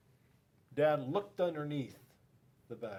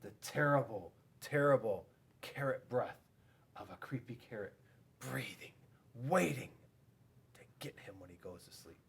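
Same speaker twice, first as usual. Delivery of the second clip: spooky hush; 2 semitones lower than usual. A narrator's reading. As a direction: draw them into the dark, hushed. Spooky bedtime narration.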